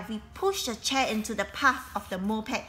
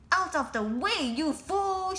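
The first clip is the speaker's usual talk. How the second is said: shouted; 9 semitones higher, slower than usual. A narrator's reading. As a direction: yell at him to move. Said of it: "she shouted".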